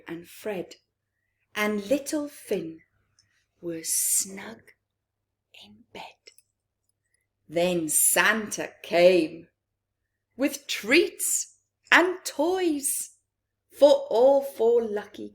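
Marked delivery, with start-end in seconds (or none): none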